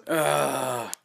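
loud drawn-out "ugh"; exasperated groan. Real, not acted. Vlogger facing an errand, exasperated groan. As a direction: groan at the chore.

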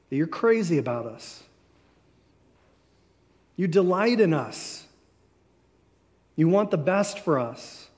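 closing prayer. Acted. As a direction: pray it with reverent pauses.

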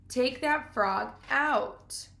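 drawn out, very slow; slow call. Acted. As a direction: stretch each word slowly. Fern calls to Avery.

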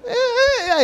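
high squeaky stammer; cornered man squeaking to the building manager; voice breaking. Acted. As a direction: let the voice crack up high. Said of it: "eu virei um salsicha".

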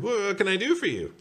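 very high, helpful sing-song; chirpy high customer-service voice; baker on phone. Acted. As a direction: offer help way up high. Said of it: "the baker answered".

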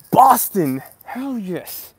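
Boston shouted loud and high; excited cheer. Real, not acted. Greeting hometown Boston viewers with a loud, excited cheer.

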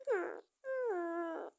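short mock sobs; sobbing. Upset child sobbing after the quarrel. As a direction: whimper twice.

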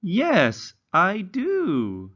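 slow, high, sing-song storybook reading; reading to children. Acted. Teacher reading a simple story to young English learners, slow and sing-song. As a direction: read it slowly like a picture book for little ones.

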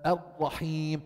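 الرحيم with a steady ra and long ee; untrilled ra. Shown as a demonstration. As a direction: hold the tongue still on the ra of الرحيم. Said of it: "شو مطلوب تقول".

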